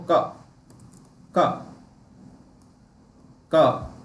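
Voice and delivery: short, isolated क, said carefully; pronunciation demo. Shown as a demonstration. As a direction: model the sound क on its own. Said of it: "sound को ध्यान से रखो". (क का उच्चारण दिखाते हुए)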